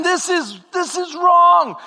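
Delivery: high, slow, loud protest; slow loud indignant protest. Retold or imitated. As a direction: insist it's wrong. Protesting to manager.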